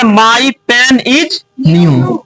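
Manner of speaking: each word read out very slowly and clearly; slow dictation. Shown as a demonstration. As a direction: spell it out slowly for the class. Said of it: "पहला sentence है". (बहुत धीरे, साफ़-साफ़ बोलकर)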